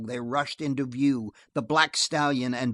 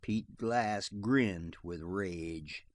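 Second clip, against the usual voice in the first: ordinary delivery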